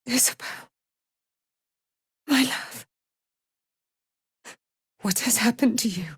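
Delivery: unvoiced, broken whisper; grieving whisper. Aylin finding her love Isabel, grieving whisper.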